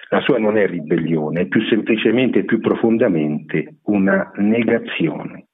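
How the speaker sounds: usual speaking voice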